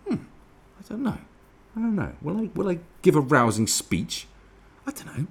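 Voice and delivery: tipsy bloke musing aloud; dithering drunk voice. Retold or imitated. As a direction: waver over the eighth pint. Drunk pondering pint, dithering.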